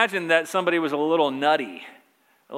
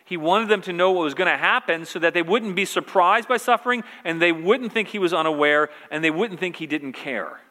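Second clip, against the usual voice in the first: ordinary delivery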